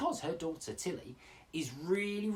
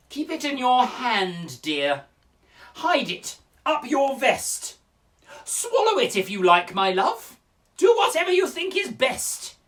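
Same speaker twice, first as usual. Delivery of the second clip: loud, high swoops falling on the endearments; exasperated teacher; 10 semitones higher, 10 dB louder, slower than usual. Acted. Teacher fobbing off a complaining pupil, loud and exasperated. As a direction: answer the pupil with theatrical exasperation.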